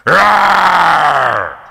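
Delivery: loud playful monster roar. Pretend. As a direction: roar to scare the kids. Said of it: "Did I scare ya?".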